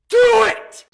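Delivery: sudden high shouted motivational yell; Shia LaBeouf. Retold or imitated. Inner voice yelling at a vlogger to get working. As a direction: bark it like a frantic motivational speaker. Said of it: "sounds like Shia LaBeouf".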